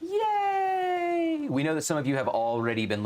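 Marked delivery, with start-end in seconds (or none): 0.0-1.5 high shouted yay; excited cheer. Real, not acted. Host cheering the Tuesday shop update, shouting yay. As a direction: cheer it like great news.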